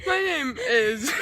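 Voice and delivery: laughing. Real, not acted. Giggling through intro retake.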